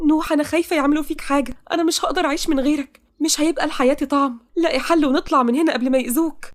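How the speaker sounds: high-pitched worried pleading; frightened heroine. Acted. Heroine fears they will hurt her beloved, anxious.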